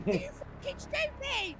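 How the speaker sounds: ordinary delivery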